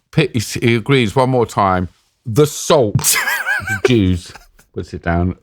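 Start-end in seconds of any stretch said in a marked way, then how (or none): 2.3-4.3 can't keep a straight face, laughing; laughing through it. Real, not acted. Telling the Taliban judge story, laughing mid-quote. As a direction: quote breaks into real laughter. Retelling judge while cracking up.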